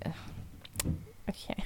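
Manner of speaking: whispered groan of distaste; whispering her dislike of the chef; exasperated whisper. Retold or imitated. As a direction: breathe it out, fed up. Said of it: "and I'm like".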